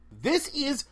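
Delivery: high, urgent, stammering call to stop; urgent outburst. A narrator's reading. Witch bursting out to stop the standoff, urgent. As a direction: blurt it out, stumbling, to halt everyone. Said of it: "That voice was loaded with considerably more emotion".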